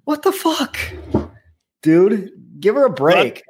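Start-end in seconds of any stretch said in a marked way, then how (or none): 0.0-0.8 high, incredulous what the fuck. Real, not acted. Reacting to Brandon not defending his fiancee, incredulous. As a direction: throw up your hands in disbelief. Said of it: "outraged rhetorical cry".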